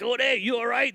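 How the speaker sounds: high-pitched mock call across a street. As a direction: imitate friends hailing someone from afar. Friends calling from across the street.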